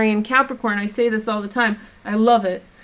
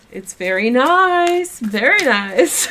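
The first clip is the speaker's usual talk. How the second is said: Borat impression; 2 semitones higher, slower than usual. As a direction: draw out very nice Borat-style, Eastern European accent. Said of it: "I do Borat".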